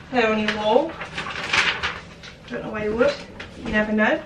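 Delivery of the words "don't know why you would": ordinary talk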